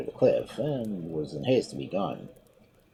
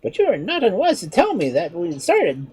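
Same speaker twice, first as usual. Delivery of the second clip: higher, lighter voice for the sly monkey; clever monkey; 14 semitones higher, 6 dB louder than usual. Acted. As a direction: play the monkey, a touch mocking. Monkey speaks.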